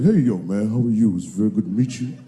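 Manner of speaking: deep, smooth, friendly low voice; O.J. Simpson impression. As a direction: lay on a deep, easy charm. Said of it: "He's like".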